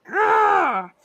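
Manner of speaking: angry distorted growl; fuzz distortion. Acted. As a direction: snarl it through a distortion. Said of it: "speak Sylvan into it in a very angry, distorted voice".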